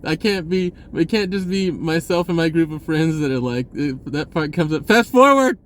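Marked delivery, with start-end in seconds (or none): 4.9-5.6 much higher, louder shout of "fast forward"; yell. Retold or imitated. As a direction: yell at the TV to skip the scene. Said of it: "you always hear somebody yell".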